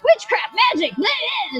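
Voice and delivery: mock mob shouting. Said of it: "they're all shouting shit like".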